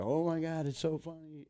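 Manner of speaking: imitated high-pitched gushing; delighted crowd. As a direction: gush like a thrilled wedding guest. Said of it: "everybody's like".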